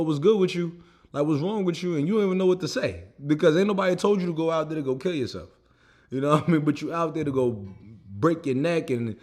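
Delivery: normal talking voice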